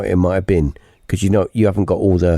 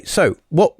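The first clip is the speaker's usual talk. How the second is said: ordinary talk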